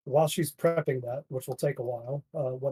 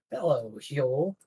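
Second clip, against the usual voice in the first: slow, strange crow voice; drawn-out greeting from a crow; talking crow; slower than usual. Acted.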